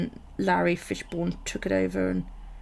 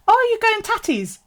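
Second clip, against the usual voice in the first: high, loud, sing-song mum voice; imitating mum; 9 dB louder than usual. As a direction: play the mum's chirpy question, pitched high. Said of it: "my mum turned around to her and she went".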